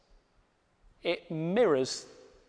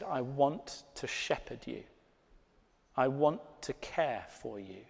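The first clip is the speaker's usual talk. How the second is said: tender voice of God; 3 semitones lower, 8 dB quieter than usual. Acted. God's words to you, tenderly.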